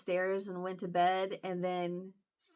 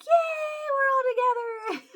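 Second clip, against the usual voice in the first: very high, loud mock squeal; gleeful cheer; 6 dB louder, slower than usual. Cheering that the family's together, gleeful squeal. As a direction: squeal it out in hammed-up joy.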